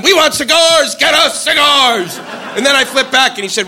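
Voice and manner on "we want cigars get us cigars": high, chanted yell, slowing on the end; mock crowd scream. U N delegates demanding cigars, mock screaming. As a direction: imitate a clamoring crowd. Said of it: "they were screaming at".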